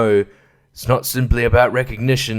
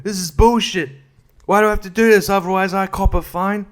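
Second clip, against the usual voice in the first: whining complainer, pitched higher; grumbling local; 7 semitones higher than usual. Retold or imitated. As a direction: gripe about having to vote, voice up high. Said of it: "angry about it".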